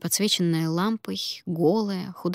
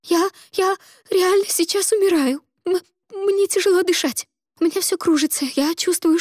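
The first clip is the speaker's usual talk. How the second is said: high, loud panicked sobbing; crying on the phone; 11 semitones higher, 6 dB louder than usual. Acted. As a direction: gasp it out, terrified of dying. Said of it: "рыдала Слава в трубку".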